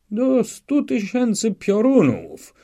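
high, loud, drawn-out bellow; outraged officer's roar; furious roar. A narrator's reading. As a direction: roar the oath slowly at full voice. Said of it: "ryknął na Szwejka przewodniczący komisji".

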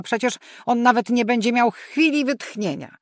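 protesting loudly, with "chwili" stressed; exasperated protest. A narrator's reading. Mother protests the task list.

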